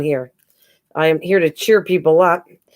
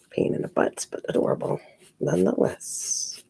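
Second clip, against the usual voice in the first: whispered aside; 9 dB quieter than usual. Real, not acted. Whispered aside about the cats.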